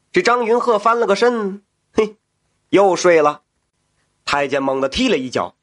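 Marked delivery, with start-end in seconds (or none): none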